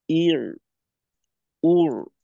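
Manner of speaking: ইর, উর cut short after the ain; short clipped vowel. Shown as a demonstration. Teacher shows the student the shorter vowel. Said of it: "সময়টাকে আর একটু সংক্ষেপ করতে হবে".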